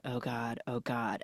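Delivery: nervous dread. Retold or imitated. The viewer frozen in tension, soft and low. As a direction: breathe it in hiding panic.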